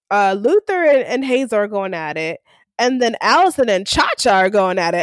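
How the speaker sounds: ordinary talk, nothing marked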